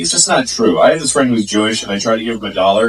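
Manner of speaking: ordinary talk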